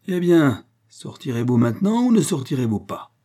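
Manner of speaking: high, loud demand; commanding question, starting with a burst; triumphant challenge. A narrator's reading. Unmasked millionaire challenges the readers.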